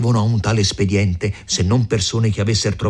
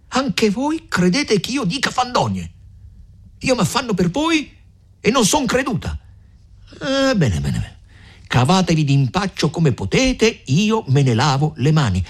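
higher, huffy and hurt; offended mother; 12 semitones higher than usual. A narrator's reading. Agnese stung that the young ones doubt her, higher and huffy. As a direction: wash your hands of them, piqued.

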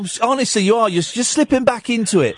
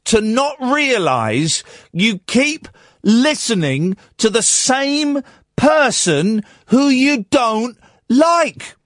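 slow, higher, pointed scolding of a caller; 6 semitones higher, slower than usual. Real, not acted. Radio host scolding a texter who insults him, slow and pointed. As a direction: drag every word out to belittle him.

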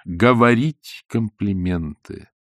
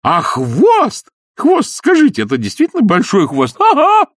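high, loud ingratiating voice; butter her up shrilly; fawning gush; 13 semitones higher, 6 dB louder than usual. Acted. Изнуренков fawning over the queen about the cat. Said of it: "заискивающе спрашивал".

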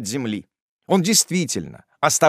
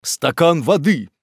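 measured call for help, only slightly raised; humane request; 4 dB louder, slower than usual. A narrator's reading. Kindly Pickwick calls for help for the fainted aunt, concerned. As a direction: ask for it with concern, unhurried. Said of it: "сказал человеколюбивый мистер Пиквик".

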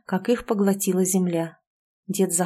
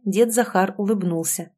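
ordinary delivery, nothing marked

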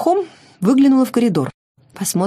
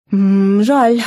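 disappointed; 5 dB louder than usual. Acted. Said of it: "разочарованно протянула Шанталь".

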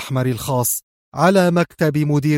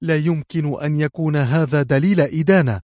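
ordinary delivery

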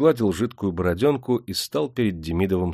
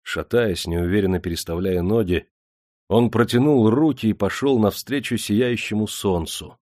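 usual speaking voice

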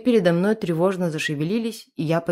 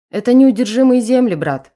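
much higher, loud shout; 6 semitones higher, 9 dB louder than usual. A narrator's reading. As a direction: bellow it at the groom.

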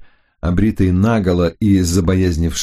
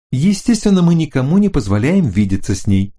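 higher, hurried female voice; hasty; 6 semitones higher than usual. Acted. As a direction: rush to say it, pitched high. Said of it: "поспешила заявить Флоринда".

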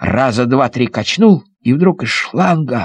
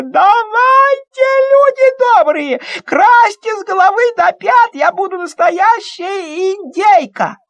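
much higher, slow ecstatic squeal; squealing with delight; slower than usual. Acted. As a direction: squeal with joy like a little girl. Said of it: "прямо завизжала от восторга". (визжит от восторга)

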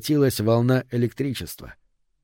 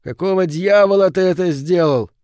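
high, loud furious demand; outraged yell; 7 semitones higher, 6 dB louder than usual. A narrator's reading. Barman demands why his jukebox was smashed, outraged yell. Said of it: "завопил он".

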